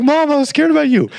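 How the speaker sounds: high, quick protest to mom; pleading kid. Retold or imitated. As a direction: imitate your younger self making excuses. Retold child pleading with angry mom.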